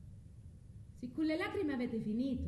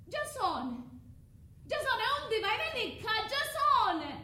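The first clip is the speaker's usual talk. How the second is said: desperate high shout of his name, much louder; calling after Jason; 13 semitones higher, 4 dB louder than usual. Acted. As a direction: scream his name after him as he leaves. Medea calls after Jason leaving.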